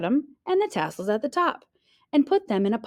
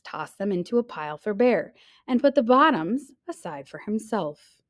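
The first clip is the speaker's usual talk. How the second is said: ordinary delivery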